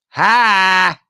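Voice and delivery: one loud, high "ha"; loud, drawn-out fake ha; mock laugh. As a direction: bark a single forced laugh. Mock laugh at flight plans.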